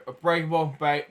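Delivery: plain reading voice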